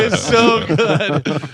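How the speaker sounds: slow words through laughter; laughing. Real, not acted. Host still laughing at the Sandler story, slow. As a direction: let the laugh stretch the words out.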